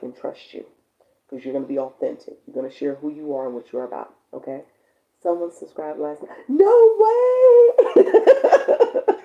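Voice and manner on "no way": high, loud squeals of disbelief; thrilled no way squeal; excited squeal. Real, not acted. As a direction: shriek with delighted surprise. Excited reaction to new subscriber.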